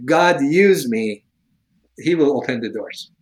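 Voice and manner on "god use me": slow earnest prayer. Shown as a demonstration. Showing the host how to pray, slow and earnest. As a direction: offer yourself slowly, meaning every word. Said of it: "you just say".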